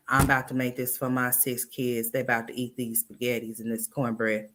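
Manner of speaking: flat, lifeless imitation; monotone voice. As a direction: keep it all on one note. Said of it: "she just got a monotone".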